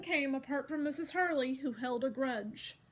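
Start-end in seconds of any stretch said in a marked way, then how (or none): none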